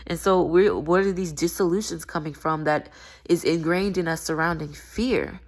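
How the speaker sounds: ordinary talk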